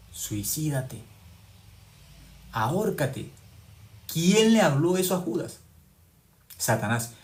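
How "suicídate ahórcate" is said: Satan's voice. Retold or imitated. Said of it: "¿Quién le dijo, Judas".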